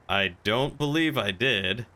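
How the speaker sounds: usual speaking voice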